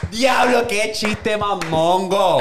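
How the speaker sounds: slow, high-pitched outburst; exasperated exclamation. Real, not acted. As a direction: cry out at a terrible joke. Exclaiming at a bad joke.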